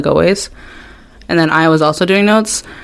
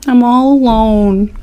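mock sad; 6 semitones higher, much slower than usual. Mock self-pity after being left out.

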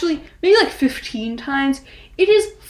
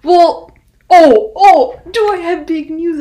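excited; 6 semitones higher, 12 dB louder, slower than usual. Real, not acted. Announcing big news.

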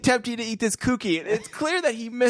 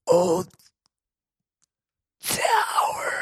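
high breathless whispered gasp; hammed-up final breath; dying whisper; much slower than usual.

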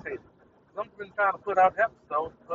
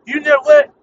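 climbs higher and louder each time; building rhetorical shout; 8 semitones higher, 13 dB louder than usual. Real, not acted. Podcaster venting at his app, building to a shout. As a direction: ramp it up into a shout.

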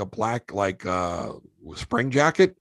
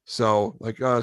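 ordinary talk, nothing marked

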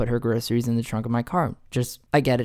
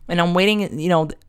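ordinary talk

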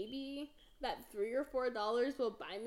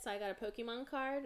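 usual speaking voice

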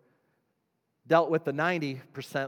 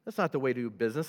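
normal talking voice

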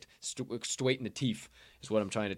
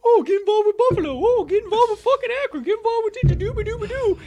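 much higher, louder and fast sneering squeak; mocking falsetto; 12 dB louder than usual. Mocking the tip voices that tempt him to bet. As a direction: mimic the tempting voices in a shrill mock.

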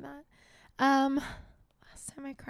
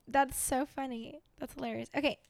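ordinary talk, nothing marked

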